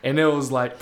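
ordinary talk, nothing marked

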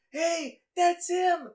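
very high, loud strained impression; film villain's shrieked accusation. Imitating a killer blaming the warrior, high mock shriek. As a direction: screech it, dragging out the last word. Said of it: "he actually speaks like that".

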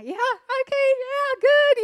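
high-pitched, breezy brush-off through a smile; breezy smiling acknowledgement. Retold or imitated. Walking past people yelling at the family, breezy and high. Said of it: "smile and go like that".

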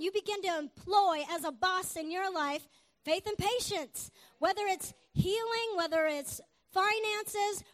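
rally the congregation with rolling conviction; emphatic preacher's cadence; preaching. Real, not acted.

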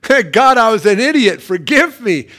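loud, comic plea to God; calling out. Acted. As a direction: holler for forgiveness from inside the fish. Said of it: "you'd, like, be calling out".

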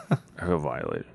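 a half-joking hushed aside; quiet repeat. Real, not acted. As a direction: let it sink, hushed and low.

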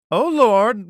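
high, loud pleading cry; dramatic cry. Acted. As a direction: cry out to the Lord with the whole chest. Dramatic opening of the psalm.

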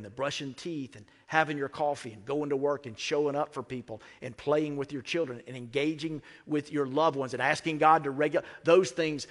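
ordinary delivery

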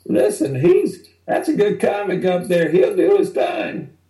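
club owner defending comic. Retold or imitated. Club owner defends the comic to Lewis, high and pleading. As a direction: pitch it way up like the nervous club owner. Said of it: "Jim Butler goes".

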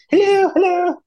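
very high, sing-out Queen's English greeting. Retold or imitated. Mum answering the phone to a caller, exaggerated Queen's English. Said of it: "she'd have the Queen's English".

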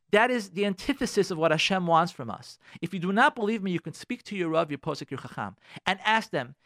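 ordinary talk

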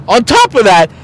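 ordinary talk

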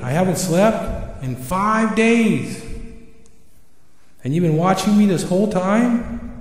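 high, indignant owl, loudest at the start; angry owl. Acted. Owl learning Creator has been watching, angry. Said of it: "Al really gets angry".

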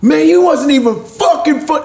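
mock angry audience. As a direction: complain like a furious customer, hammed up. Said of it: "They're so mad at you".

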